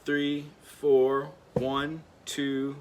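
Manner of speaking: normal talking voice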